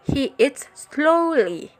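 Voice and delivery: very slow, "slowly" stretched high; stress on slowly. Shown as a demonstration. Teacher modelling an emphasized adverb, stressed "slowly". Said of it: "hear how the focus words are emphasized".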